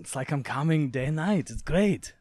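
put-on Austrian bodybuilder voice; Arnold Schwarzenegger. Showing off the only impression they can do, bodybuilder imitation. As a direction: do the famous bodybuilder's catchphrase in his accent.

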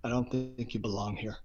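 quietly polite rejection; polite brush-off. Retold or imitated. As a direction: let him down gently but firmly. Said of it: "she's very polite".

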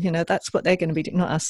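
usual speaking voice

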